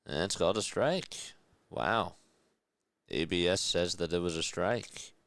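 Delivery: live baseball announcer calling the pitch; play-by-play call. Acted. Streamer calling the game for chat, in announcer voice. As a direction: call it like a broadcaster in the booth.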